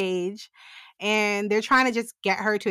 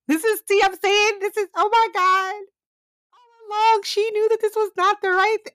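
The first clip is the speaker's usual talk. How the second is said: outraged squeal; 11 semitones higher, faster than usual. Real, not acted. Podcaster ranting about Andrea's betrayal of Tessa, high outraged squeal.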